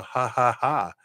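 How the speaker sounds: mock laughter; demonstrated laugh. Shown as a demonstration. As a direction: spell out the laugh as a joke signal. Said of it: "with me laughing at the end".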